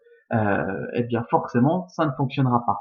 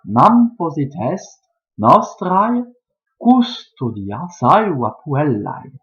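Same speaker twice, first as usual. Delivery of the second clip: scansion lente et appuyée du vers latin; vers scandé; 3 semitones higher, 4 dB louder than usual. Shown as a demonstration. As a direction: scander le vers avec des pauses nettes. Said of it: "ça nous donne naturellement". (vers latin scandé, syllabes longues étirées)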